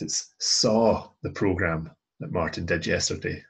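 "saw" held and punched, then the rest lighter; stress on SAW. Shown as a demonstration. Teacher translating a fronted verb for learners, stressing "saw". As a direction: land hard on "saw" as the action. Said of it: "So we're emphasizing the action".

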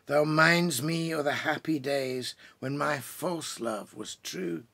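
slow, lilting lament read aloud; poem reading. Acted. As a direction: let the young woman's grief ring gently through each line. Reads The Banks of Doon.

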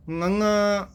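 ngੰਗਾ started with a clear velar nga; tongue to palate for ngੰਗਾ, nasal start; correct nasal ngਙ sound. Shown as a demonstration. Teacher demonstrates the exact ngੰਗਾ sound. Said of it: "ਬਿਲਕੁਲ ਸਹੀ ਉਚਾਰਨ ਕਰਨਾ ਹੈ".

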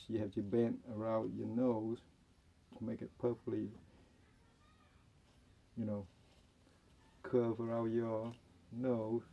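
muffled behind surgical mask; talking while fitting mask; speaking through a face mask. Real, not acted. Seen 0: Blue surgical mask held over nose and mouth while speaking.

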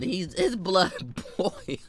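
slow, soft, laughing through it. Real, not acted. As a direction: giggle it out.

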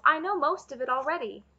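loud, high-pitched boast; calling out. A narrator's reading. Taryn brandishing a poker at Cole, calling out. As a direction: boast it out loudly. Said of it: "he called".

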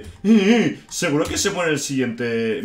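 high, loud whiny mock of other players; jeering high-pitched impression of fretting fans; mocking the crowd. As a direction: parrot the doomsayers in a squeaky taunting voice. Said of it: "Y la gente".

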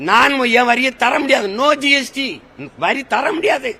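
a chief minister defying the centre, loud and high; defiant refusal. Pretend. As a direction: throw the refusal back like a challenge. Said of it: "உன்னால முடிஞ்சதை பாருன்னு சொல்லணும்ல".

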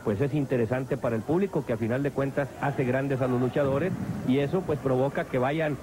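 live ringside play-by-play; wrestling commentator. Acted. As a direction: call the match as it happens.

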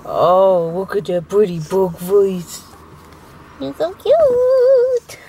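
put-on slow cooing baby talk for a pug. Shown as a demonstration. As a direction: coo slowly at the dog like baby talk. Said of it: "George loves my pug voice".

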